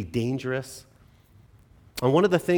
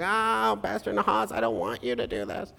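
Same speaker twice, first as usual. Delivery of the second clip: high, softer whiny wail; mock crying child; 6 semitones higher, 3 dB quieter than usual. Imagined child crying to the pastor, mock crying. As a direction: imitate a kid starting to cry. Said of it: "To start crying".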